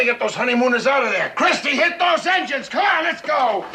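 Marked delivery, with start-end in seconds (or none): 1.4-3.6 urgent shouted command; shouted order. Acted. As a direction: bark the order to hit the engines. Captain orders engines started.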